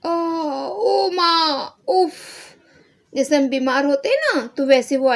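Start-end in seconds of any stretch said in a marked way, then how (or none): 0.1-2.1 drawn-out, very slow, high mock wail; hammed-up sick boy calling his mother; loud groan. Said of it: "जोर से". (ज़ोर से कराहना, नाटकीय)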